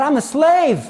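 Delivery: high, loud lament, crying out in despair; mock-crying wail of doom; retold tearful despair. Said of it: "I started to cry".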